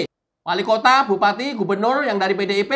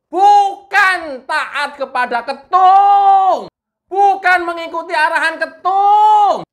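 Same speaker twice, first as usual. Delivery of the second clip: drawn-out high, loud indignant shout; angry rebuke; 8 semitones higher, 6 dB louder, slower than usual. Real, not acted. Commentator berating party's regional heads for obeying the chairman, angry shout. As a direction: holler it, stretching the key words long. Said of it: "jangan marah-marah".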